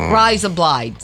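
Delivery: smiling Australian accent. Shown as a demonstration. Host showing the cheek trick, Australian accent. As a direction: say it through an Aussie smile. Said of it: "kinda smile like that".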